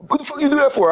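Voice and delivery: high, whiny mock complaint; mock Wayno. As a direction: squeak it out like the annoyed friend. Voicing angry woken-up Wayno.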